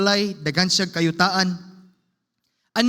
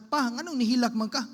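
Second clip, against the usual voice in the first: family comforting; 5 semitones higher than usual. Retold or imitated. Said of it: "ingon niyang iyang asawa, iyang mga anak".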